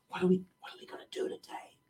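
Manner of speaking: quick hushed whisper, no voice at all. Retold or imitated. Disciple whispering to a friend by the fire, hushed. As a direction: whisper it fast so the others won't hear. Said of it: "somebody's whispering".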